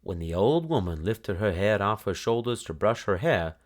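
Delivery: plain reading voice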